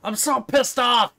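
heated, acted-out fury; angry threat. Neighbor's furious death threat.

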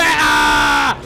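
loud, drawn-out cry mid-sentence; startled yell. Real, not acted. Player cut off while boasting, startled yell. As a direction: yell out as if suddenly hit.

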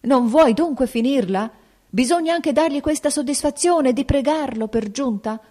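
father yielding but furious at his feverish daughter; acrid outburst. A narrator's reading. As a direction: snap at her, giving in against his will. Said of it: "proruppe col tono acre".

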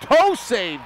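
excited shout. Real, not acted. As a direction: erupt at the big save. Big save called live.

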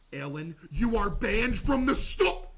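hammed-up store ban; mock ban announcement. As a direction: lay down the law playfully. Banning a chat member.

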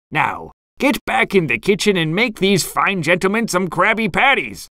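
Mister Krabs. A narrator's reading. Boss sending fry cook into kitchen, ordering. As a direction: order the cook back to work. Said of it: "Mister Krabs ordered".

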